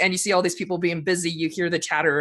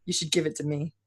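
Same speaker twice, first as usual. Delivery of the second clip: quiet; 3 semitones lower, 7 dB quieter, faster than usual. Acted. Game master voices enigmatic woman.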